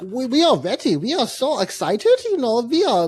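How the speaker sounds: lightly voiced eager European tourist; German accent. Tourists boasting they are ready for heat, mock German. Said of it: "I don't know why they're German".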